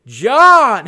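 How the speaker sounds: loud, slow, drawn-out cat whine for his owner; hammed-up cartoon cat wail; Garfield impression. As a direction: bellow for lasagna with long stretched vowels. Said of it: "guess Garfield there".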